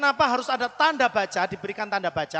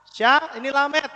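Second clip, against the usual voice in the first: usual speaking voice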